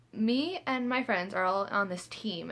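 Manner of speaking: ordinary delivery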